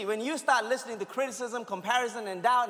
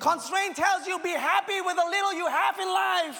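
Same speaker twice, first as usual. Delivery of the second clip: high, loud preacher's cry building through the lines; rising, loud sermon delivery; full-force preaching; 8 semitones higher, 4 dB louder than usual. Acted. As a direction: preach it out loud to the whole room.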